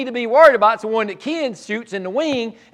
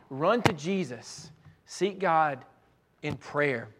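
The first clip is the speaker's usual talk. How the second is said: quiet; 7 semitones lower, 9 dB quieter, slower than usual. Acted. Preacher repeats exhortation, hushed and slow.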